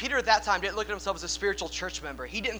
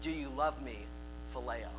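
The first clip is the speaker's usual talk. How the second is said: quiet sorrowful question; 7 semitones lower, 8 dB quieter than usual. Acted. Jesus' third question.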